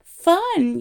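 high, loud indignant yell; outraged yell. A narrator's reading. Arthur outraged at Ford saying let them have fun, yelling. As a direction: yell it in sheer disbelief. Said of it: "yelled Arthur".

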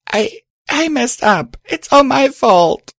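high, anguished confession; choked with tears. A narrator's reading. Star finally confessing to Lucky, tearful. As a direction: stumble in, then wail the guilt. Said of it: "my voice choking with tears".

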